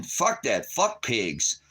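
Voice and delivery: ordinary delivery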